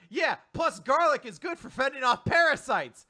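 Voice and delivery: cheerful know-it-all. Acted. Recapper acts the girl adding a garlic tip, cheerful. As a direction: toss in a fact brightly.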